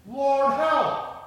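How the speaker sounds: high, forceful shout to God; cry for help. A narrator's reading. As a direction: call out loudly for rescue. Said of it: "guess what they cried".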